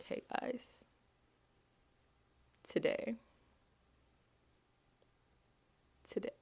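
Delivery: whispered, breaking up. Pretend. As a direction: try to say it and choke. Telling friends she's struggling.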